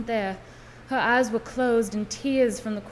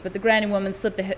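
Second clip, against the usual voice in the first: ordinary delivery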